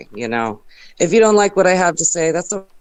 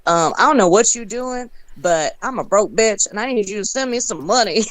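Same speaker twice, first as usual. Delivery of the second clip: higher-pitched Southern comedian impression; Southern accent; 5 semitones higher than usual. Friend relaying Southern comedian's joke video, Southern accent.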